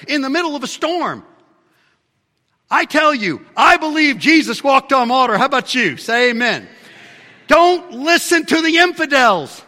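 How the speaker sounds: high, loud, full-force pulpit cry; fiery preaching. Acted. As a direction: rouse the church against the infidels.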